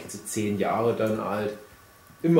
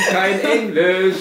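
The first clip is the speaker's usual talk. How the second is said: higher, louder, through laughter; laughing; 7 semitones higher, 7 dB louder, slower than usual. Real, not acted. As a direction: laugh the words out slowly. Laughing punchline.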